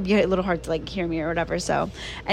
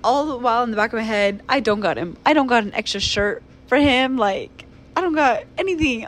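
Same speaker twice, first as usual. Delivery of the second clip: usual speaking voice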